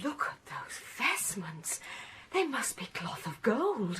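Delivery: gasp, then a soft awed whisper. Acted. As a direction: breathe it out in wonder. Awed at vestments in crypt.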